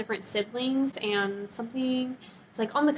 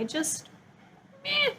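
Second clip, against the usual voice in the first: dismissive meh; 14 semitones higher, 7 dB quieter, slower than usual. Real, not acted. Dismissing boring book.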